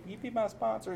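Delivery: mock date ask. Newcomer asking for a sponsor, shy.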